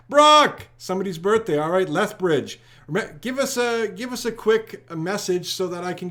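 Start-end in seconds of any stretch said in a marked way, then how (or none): 0.1-0.7 teasing shout. Real, not acted.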